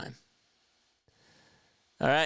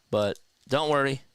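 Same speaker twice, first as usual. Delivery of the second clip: usual speaking voice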